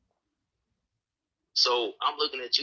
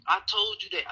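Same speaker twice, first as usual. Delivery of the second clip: usual speaking voice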